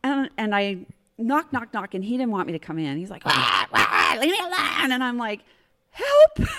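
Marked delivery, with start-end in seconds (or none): 3.2-4.9 whiny mock shout of a pestered brother; annoyed brother's yell. Brother yelling through door. 5.9-6.5 high, loud mock yelp; loud, high-pitched plea, played up; retold cry for help. Retold plea at brother's door.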